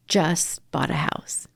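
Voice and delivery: slow, hushed reveal; hushed whisper. Real, not acted. Telling the host the dishwasher bought a house, hushed whisper. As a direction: let it out slowly like a secret.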